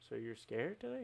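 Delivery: softly tossed-off question, imitating an interviewer; offhand mock-interviewer query; nonchalant. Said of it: "like, nonchalant".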